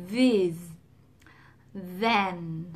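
these and then with a buzzing voiced th. Shown as a demonstration. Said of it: "Thật ra nó là". (đọc these, then có rung)

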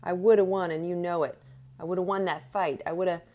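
loud, insistent boxer's outburst; angry protest. A narrator's reading. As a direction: hammer at the manager, furious. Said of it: "whirled on Donatelli".

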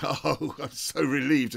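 strained through-the-teeth relief; forced relief. Retold or imitated. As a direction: sound relieved while furious.

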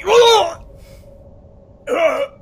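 high, loud groan of a crushed man; acted cry of crushing pain. Game master as a man crushed against the wall, groaning in agony.

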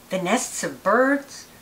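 ordinary delivery

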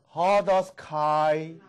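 hodos said slowly, with a breathy H before the o; Greek word drill. Shown as a demonstration. Greek teacher drilling a class, slow clear demo. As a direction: stretch out hodos kai, breathing the H. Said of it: "那个有气号，H在前面的".

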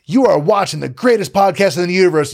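pumped podcast intro; hyped announcer. Shown as a demonstration. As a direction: sell it with charisma. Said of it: "saying it up here and I'm projecting".

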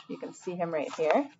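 ordinary delivery

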